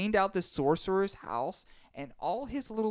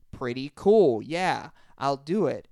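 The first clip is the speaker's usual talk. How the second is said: loud; 7 dB louder than usual. Acted. Grandpa eagerly agrees.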